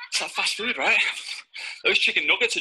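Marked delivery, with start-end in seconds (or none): none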